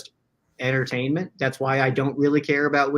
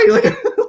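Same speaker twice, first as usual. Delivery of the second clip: excited laugh; 9 dB louder than usual. Retold or imitated. Losing his mind over trade.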